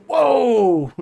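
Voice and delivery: loud, high mock yell; imitated startled shout; startled whoa. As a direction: blurt out a big shocked whoa. Retold startled reaction on stairs.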